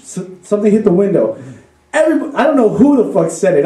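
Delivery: ordinary talk, nothing marked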